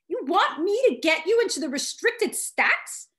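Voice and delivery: high, startled squeak; squeaky voice. A narrator's reading. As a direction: voice jumps up in alarm. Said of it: "My voice squeaked".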